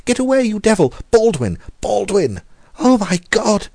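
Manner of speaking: high, loud desperate cries for help; terrified screams. A narrator's reading. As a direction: scream for Baldwin as the cat attacks. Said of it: "he screamed".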